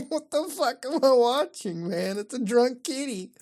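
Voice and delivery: high-pitched, still giggling; amused, incredulous high voice; laughing disbelief. Real, not acted. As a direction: talk through the tail of a laugh. Still laughing at kitty picture.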